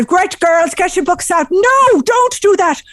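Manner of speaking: high-pitched strict Irish schoolmistress; Missus Cooney imitation. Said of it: "classic imitation of Missus Cooney".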